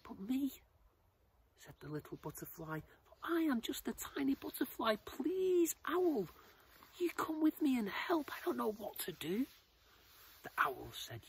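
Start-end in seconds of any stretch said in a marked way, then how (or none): 0.0-0.6 high-pitched little creature voice; startled little butterfly. Acted. Chosen butterfly doubts itself to the owl, high and startled. 3.2-9.5 very high, loud, desperate little voice; pleading tiny butterfly. Acted. Tiny butterfly begs owl to come help, high desperate plea.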